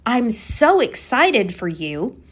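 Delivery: drawn-out, excited congratulation. Shown as a demonstration. Coach showing a matching tone of voice, excited. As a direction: gush it slowly, stretching the excitement. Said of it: "you hear the difference there".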